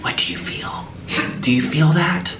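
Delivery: hushed, breath-heavy mystic questioning; crazy German lady. Retold or imitated. German lady asking the guest with her hand in the rock, hushed mock-mystical. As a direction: mimic an expectant healer, half whispered. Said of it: "the German lady says".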